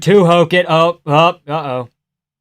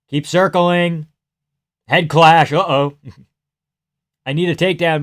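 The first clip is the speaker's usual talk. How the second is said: normal talking voice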